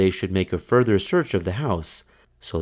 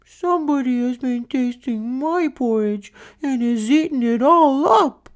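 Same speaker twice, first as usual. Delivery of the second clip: very high, rising to a loud squeak; tiny wee bear crying; 4 dB louder than usual. Acted. Little bear discovering his porridge gone, high crying voice. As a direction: squeal it like the smallest cub. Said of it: "wee bear cried".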